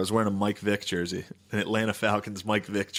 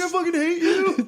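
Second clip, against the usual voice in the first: very high, wailing squeal; comic teenage meltdown; mock tearful tantrum; 4 dB louder than usual. Son fleeing upstairs from his dad, mock tearful tantrum.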